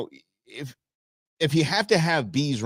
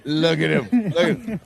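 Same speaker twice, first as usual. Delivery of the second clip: high, cooing pet voice between laughs; 6 semitones higher, 3 dB louder than usual. Real, not acted. Host cooing at a fluffy dog in a video, laughing baby talk. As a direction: gush over the fluffy doggy like a puppy lover.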